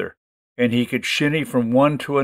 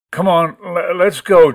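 higher and louder, forced bravery; nervous gulp; 5 semitones higher, 5 dB louder than usual. A narrator's reading. Frightened boy steeling himself to climb, gulping bravado. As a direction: swallow the fear and push it out. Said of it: "Joey gulped".

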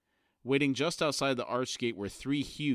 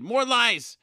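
ranting madman; 7 semitones higher, 7 dB louder than usual. Acted. Pust interrupts in a rage.

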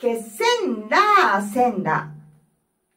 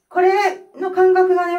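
usual speaking voice

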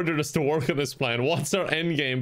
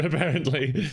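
usual speaking voice

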